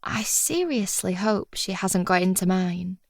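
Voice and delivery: usual speaking voice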